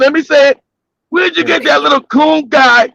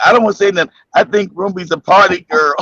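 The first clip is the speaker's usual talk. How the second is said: deep-voiced imitation of Spike; low mock voice; 6 semitones lower, faster than usual. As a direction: drop low and play it sly. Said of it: "Spike said".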